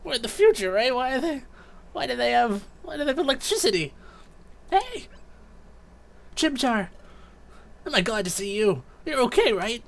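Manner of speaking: high-pitched, excitable game sidekick; anxious young partner. Acted. Bewildered captive questioning the spotlights, high and anxious. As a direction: pitch it way up, full of worried questions.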